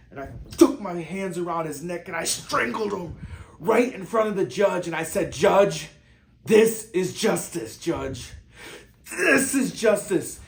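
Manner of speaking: loud, high, furious cry for justice; enraged shouting. Acted. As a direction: roar it at the judge, stretching key words. Acted courtroom strangling, cries to judge.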